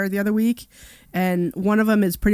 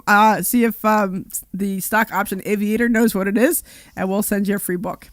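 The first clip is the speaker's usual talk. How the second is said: normal talking voice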